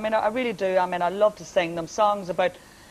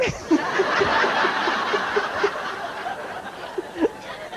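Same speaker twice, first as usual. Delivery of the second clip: long high laugh; 12 semitones higher than usual. Real, not acted. Audience-style laugh.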